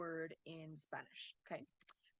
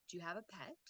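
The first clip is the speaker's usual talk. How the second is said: "pet" rises sharply at the end; softly ask, lifting PET; yes-no question intonation; 5 dB quieter, much faster than usual. Shown as a demonstration. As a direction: let "pet" climb into a question. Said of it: "yes or no question".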